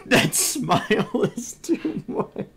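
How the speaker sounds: high, slow, laughing it out; cracking up at the smile. Real, not acted. Laughing, flustered reaction.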